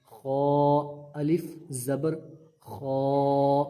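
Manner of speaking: خو said full and heavy. Shown as a demonstration. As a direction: round and thicken the خو. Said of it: "اس کو موٹا پڑھیں گے".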